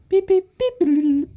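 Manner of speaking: high beeping ring; imitated office phone. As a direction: beep like a ringing office phone. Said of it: "their phones in the office".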